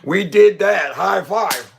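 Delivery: hammed-up gloating; mock gloating. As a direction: play the trolls celebrating their win. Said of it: "You say".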